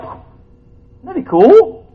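high, loud cool; delighted question; excited. Real, not acted. Preacher joking about a voice that controls kids, high and excited.

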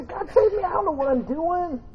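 hammed-up desperate plea to God; mock panicked cry. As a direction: cry out like you're sinking. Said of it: "I cry out and say".

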